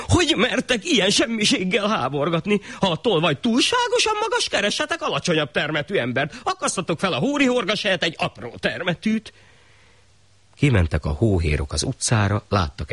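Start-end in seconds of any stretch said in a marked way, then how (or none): 0.1-2.6 high indignant royal voice; the shah scolding his executioners; shrill-pitched pompous anger; angry shah. Acted. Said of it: "Megmérgesedett a sah". 2.8-4.5 very high pompous shah voice; the shah lecturing his servants; high haughty ruler; irritated shah. Acted. Shah gives absurd order. 4.5-9.3 high shah voice with a laugh; the shah pleased with his silly idea; high, chuckling ruler; smug shah. Acted.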